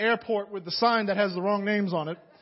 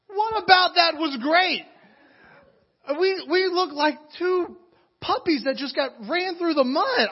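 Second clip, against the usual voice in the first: theatrical disbelief, voice raised high; boisterous, dramatic; 8 semitones higher, 4 dB louder than usual. Retold or imitated. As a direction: play up the outrage for the crowd. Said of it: "boisterous voice sometimes".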